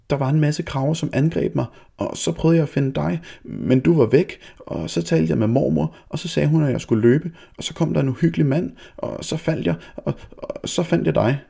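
high, breathless rush of words; girl blurting the whole story to her mother. A narrator's reading. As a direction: tumble over your own words. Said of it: "sagde Lise så hurtigt, at hun næsten faldt over ordene".

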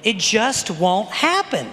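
slow, deliberate, word by word; emphatic repetition. Acted. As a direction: drive it home slowly and firmly. Slow repeat to drive point home.

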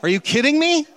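exasperated at ungrateful guests; incredulous. Retold or imitated. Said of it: "He's like".

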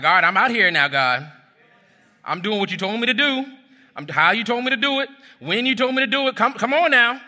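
high, pressing, half-demanding plea to God; indignant prayer. Retold or imitated. Preacher imitating his own impatient prayer, high and insistent. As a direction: push God to hurry up, a bit put out. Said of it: "I'm praying indignant".

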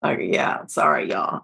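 soft, breathy apology through tears; choked-up apology. Real, not acted. To her podcast listeners, a soft choked-up apology. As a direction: recover and apologize, barely voiced. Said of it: "I got emotional".

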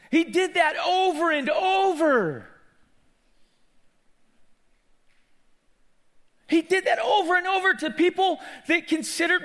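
high, loud, shouted sermon; full-force preaching. Real, not acted. Preacher marveling that Jesus stopped for outcasts, impassioned shout. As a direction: shout it out to the whole congregation.

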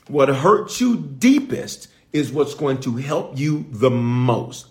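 each word laid down slowly; slow it down so it sinks in; slow, weighty delivery. Shown as a demonstration. Preacher to church, slow and deliberate. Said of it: "put a praise on the screen for that".

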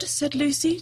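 usual speaking voice

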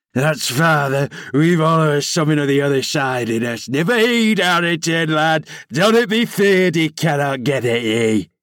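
sly, mocking old man; gloating old servant. A narrator's reading. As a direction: taunt with malicious delight. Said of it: "croaking laugh".

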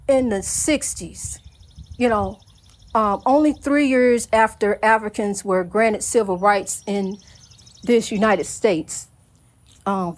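low, slow and sincere; quiet heartfelt remembrance. Real, not acted. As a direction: settle down and speak gently of mother. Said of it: "let me slow it down".

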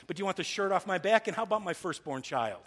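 high, loud sarcastic jab; sarcastic mock offer.

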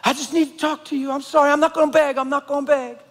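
high, insistent pleading voice; imitated pleading stranger. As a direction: imitate someone pressing a stranger to listen. Woman approaches him asking for money.

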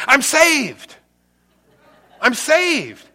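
mock-excited lady's testimony. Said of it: "she got excited".

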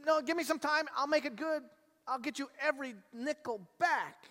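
high, pleading voice of a desperate debtor; begging slave. Retold or imitated. Slave on his knees begging the king for time. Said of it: "the guy falls on his knees and goes".

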